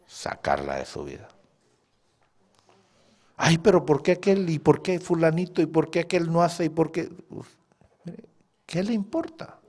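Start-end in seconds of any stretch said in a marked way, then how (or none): 3.4-7.1 mocking grumbler.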